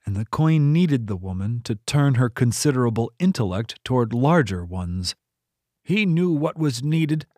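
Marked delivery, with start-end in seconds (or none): 5.8-7.3 tight, pinched, higher voice. A narrator's reading. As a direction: squeeze the words through a pinched throat. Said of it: "said in a pinched voice".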